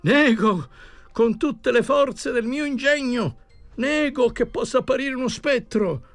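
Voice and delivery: high, strained, hysterical protests; frantic denial. Acted. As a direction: shout the denials like a man losing his nerve. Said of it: "gridò ancora".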